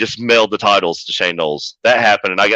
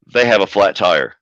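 usual speaking voice

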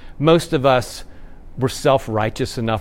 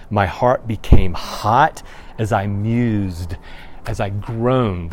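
slow solemn reading; 4 semitones lower, slower than usual. Retold or imitated. Reading the psalm slowly.

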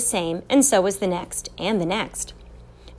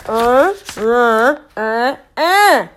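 loud, higher, rising mumbled noises; insistent closed-mouth attempt at speech; emphatic muffled grunts; 4 semitones higher, 7 dB louder, much slower than usual. A narrator's reading. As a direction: force the words through caked mud. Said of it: "so plainly that it cracked the mud".

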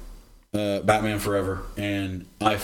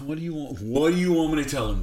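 normal talking voice

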